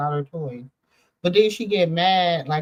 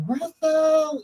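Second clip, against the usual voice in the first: higher calling voice; 11 semitones higher than usual. Retold or imitated. Owner calling her husband through the door.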